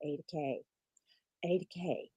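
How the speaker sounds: soothing hush. Retold or imitated. Friend calming a panicking student, soothing hush. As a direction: gently talk her down. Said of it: "I was going".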